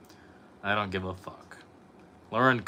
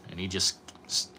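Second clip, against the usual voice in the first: ordinary talk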